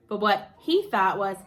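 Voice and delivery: usual speaking voice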